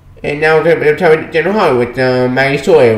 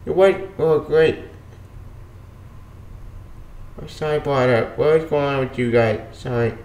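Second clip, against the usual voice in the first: soft apologetic voice; 7 dB quieter than usual. Retold or imitated. Quoting Cat's soft apology scene.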